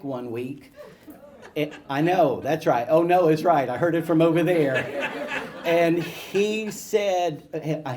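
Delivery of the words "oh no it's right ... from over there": usual speaking voice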